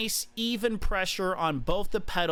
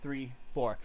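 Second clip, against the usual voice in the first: slow, even count that fades much softer; counting the beat; 3 semitones lower, 5 dB quieter, slower than usual. Shown as a demonstration. Coach counting his four-beat kick, fading count. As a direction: tick off the four beats, slow and steady. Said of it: "I like doing a four beat kick".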